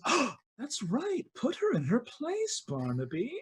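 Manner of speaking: high, soft gloating tease; Queen of Dusk eggs Barnaby on, gloating softly; gleeful taunt. Acted. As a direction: gloat over her rival. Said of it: "Queen of Dusk goes".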